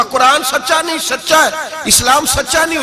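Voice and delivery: high, insistent rhetorical questions; heated questioning. Acted. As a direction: press each question urgently.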